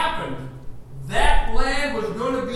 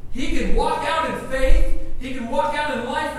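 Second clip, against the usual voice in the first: 1 preaching; 6 semitones higher, 5 dB louder than usual. Real, not acted. Preaching at full force.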